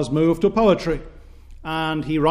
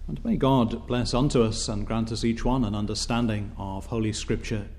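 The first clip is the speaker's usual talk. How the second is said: lower, softer prayer voice; short reverent prayer; 5 semitones lower, 7 dB quieter than usual. Real, not acted. Preacher asking God to bless the reading, reverent prayer. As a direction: close the reading with a hushed blessing, sinking to Amen.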